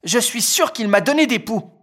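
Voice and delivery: put-on high, whiny boy; Félix voice. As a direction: complain up high like the big brother. Félix complains.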